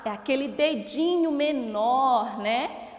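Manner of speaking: ordinary talk, nothing marked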